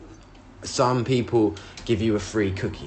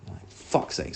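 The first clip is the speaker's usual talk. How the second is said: breathy, voiceless curse of annoyance; muttering "fuck's sake" to himself; whispered "fuck's sake"; 8 dB quieter than usual. Retold or imitated. Reacting to crash, whispered.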